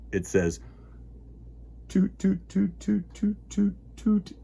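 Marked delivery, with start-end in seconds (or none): 1.9-4.3 higher, bouncy toot toot; train toots. Acted. Host imitating a train for children, higher toots. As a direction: chug out toots like a little train.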